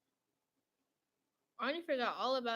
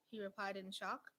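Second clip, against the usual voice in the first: ordinary delivery, nothing marked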